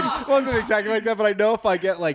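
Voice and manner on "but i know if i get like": usual speaking voice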